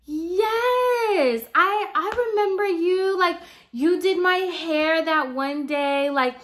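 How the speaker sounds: high, gushing fake-nice voice; fake sweet customer. Customer flattering the stylist in front of mom, fake sweet. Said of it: "she was like".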